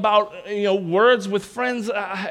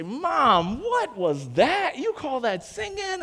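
mock-incredulous teasing, rising higher; retold teasing; 6 semitones higher, slower than usual. Seventh grader drilling into his mom about her singing, mock teasing. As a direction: tease Mom as a cocky seventh grader would. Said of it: "I just start drilling into her".

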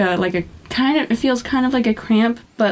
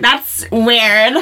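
put-on valley girl voice; 3 semitones higher, 4 dB louder than usual. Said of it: "That's what I sounded like in high school?".

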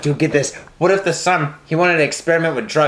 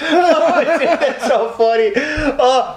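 high, slow, laughing through it; drawn-out laughing; 8 semitones higher, much slower than usual. Real, not acted. Laughing at story.

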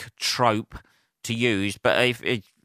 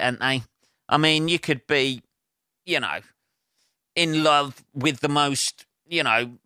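ordinary talk, nothing marked

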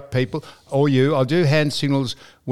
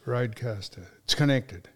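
ordinary delivery

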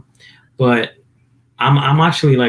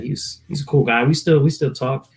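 usual speaking voice

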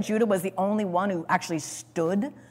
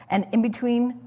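usual speaking voice

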